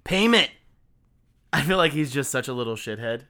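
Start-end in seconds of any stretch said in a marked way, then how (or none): 0.0-0.5 higher, louder theatrical announcement; dramatic reading. Acted. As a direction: declare it like a melodramatic title. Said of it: "as dramatically as I possibly can".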